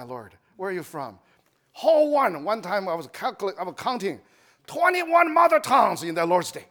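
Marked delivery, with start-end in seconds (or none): none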